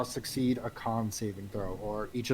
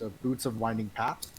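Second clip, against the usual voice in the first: normal talking voice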